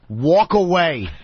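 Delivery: slow, flat "walk away"; curt brush-off. Retold or imitated. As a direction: dismiss him without looking up. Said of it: "he just went".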